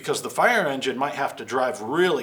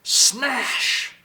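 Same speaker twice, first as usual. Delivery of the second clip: loud exclamation; 8 semitones higher, 4 dB louder than usual. Acted. Breaking the window.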